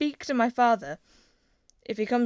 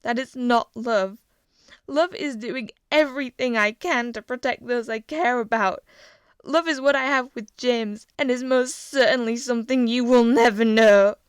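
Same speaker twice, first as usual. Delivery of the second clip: higher, louder defiant speech; 4 semitones higher, 10 dB louder than usual. A narrator's reading. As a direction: defy your father from your knees. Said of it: "feeling a small ounce of confidence".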